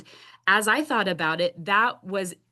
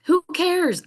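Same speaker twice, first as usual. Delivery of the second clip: defiant shrug-off; 11 semitones higher, 5 dB louder than usual. Retold or imitated. Defiant self-talk quote.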